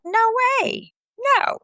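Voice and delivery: very high, incredulous no; retold high-pitched disbelief; disbelieving. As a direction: imitate a sister refusing to believe it.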